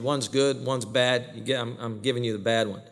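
usual speaking voice

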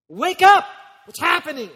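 loud, high shout from the pulpit; explosive preacher's wake-up call; yelled warning. Acted. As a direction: yell it to jolt the sleepers awake. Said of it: "yelled at you louder".

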